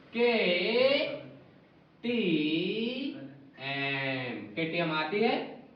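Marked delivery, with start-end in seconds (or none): 0.1-4.5 very slow, stretched KTM; teacher stretching the memory word; drawn-out mnemonic. Shown as a demonstration. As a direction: draw out KTM like a chant. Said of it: "teacher stretches key word".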